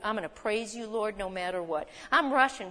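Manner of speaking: ordinary talk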